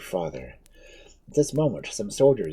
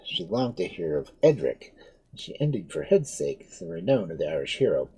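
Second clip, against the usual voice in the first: ordinary delivery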